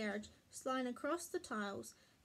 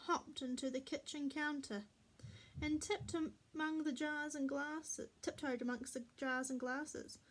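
usual speaking voice

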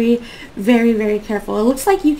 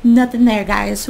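ordinary delivery

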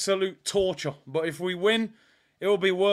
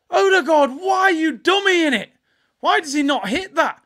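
loud, high exasperated shout at the player; frustrated yell; 8 semitones higher, 7 dB louder than usual. Real, not acted. Fan shouting at Odegaard on his stream. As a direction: demand to know why he didn't shoot.

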